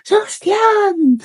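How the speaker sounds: high, long-drawn name call; drawn-out parents' call; shouting in search. Acted. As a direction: holler for the missing boy.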